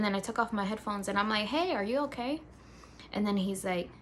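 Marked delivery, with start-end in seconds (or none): 1.5-2.4 put-on high, lilting question to a child; gentle check-in. Babysitter checking on a boy lying down, lightly concerned. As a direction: pitch it up, checking on a kid.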